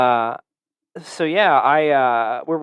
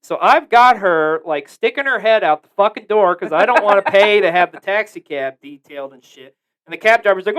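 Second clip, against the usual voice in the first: usual speaking voice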